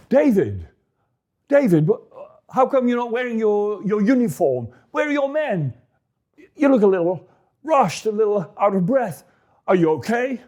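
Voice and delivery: a fretful high priest, voice up high; anxious old priest. Acted. Priest Ahimelech worried, greeting a rushed David.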